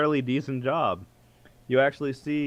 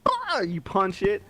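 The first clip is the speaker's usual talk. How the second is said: ordinary talk, nothing marked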